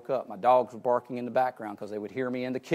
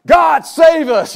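shouted high and slow, God, save us; mock yell; 20 dB louder, much slower than usual. Shown as a demonstration. Preacher showing the wrong way to pray aloud, hammed-up yell. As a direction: cry out loud to God, overdone on purpose. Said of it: "you're not gonna do that".

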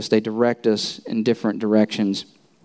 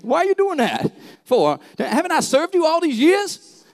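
much higher cartoon donkey, whiny and indignant; Eddie Murphy's Donkey. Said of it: "I just have Eddie Murphy in my head".

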